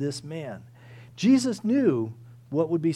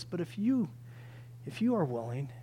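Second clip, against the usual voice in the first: humble submission; 7 dB quieter than usual. Acted. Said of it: "He comes without presumption".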